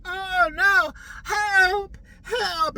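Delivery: high, loud hammed-up cries for help; terrible acting. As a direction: overact calling for help. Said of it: "the worst acting on the planet".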